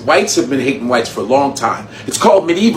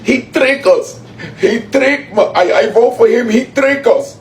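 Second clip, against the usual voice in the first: much higher, whiny mock voice; mock whining voter; 8 semitones higher, slower than usual. Imitating a voter whining that the president tricked him, high whine. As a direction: wail it like a betrayed fan. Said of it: "Now you in the camera like".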